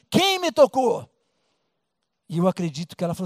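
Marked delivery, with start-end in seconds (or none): 0.1-1.1 pergunta alta e lenta, mais aguda; Jesus perguntando. Acted. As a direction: chame na multidão, devagar e forte. (Jesus perguntando, alto e lento)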